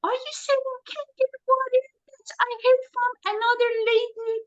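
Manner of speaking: high mocking voice of a naive lead; mocking clueless lead. Mocking a naive prospect who asks about the water. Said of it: "those leads who come and say".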